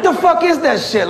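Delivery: usual speaking voice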